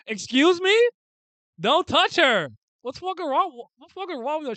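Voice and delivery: very high, loud shrieked protest; outraged yelling. Pretend. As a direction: explode in hammed-up outrage. Yelling at character threatening her.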